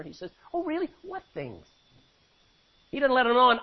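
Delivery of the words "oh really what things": feigned ignorance, high then dropping soft; playful humor. Acted. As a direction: play innocent with a high "oh really". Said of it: "I like how Jesus has humor".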